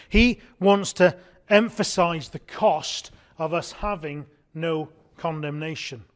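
ordinary talk

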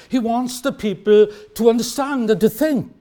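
ordinary talk

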